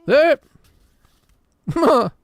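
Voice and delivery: sudden loud burst into the mic; volume spike. Real, not acted. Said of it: "Volume spikes are great".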